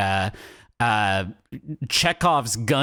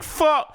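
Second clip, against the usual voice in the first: high-pitched "Fo-" chopped halfway through; word cut off; 12 semitones higher than usual. Retold or imitated. Podcaster mimics the camera cutting away from vendor, "Fo-" broken off. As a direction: start "Fo-" up high and stop dead mid-word. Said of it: "halfway through the word".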